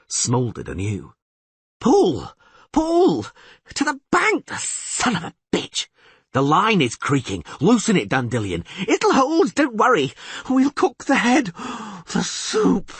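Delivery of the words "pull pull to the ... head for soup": put-on high, eager, excitable young bard; higher and louder, thrilled by the catch; excited bard voice. Dandelion marvels at the huge catfish, high and excited. Dandelion shouting while hauling.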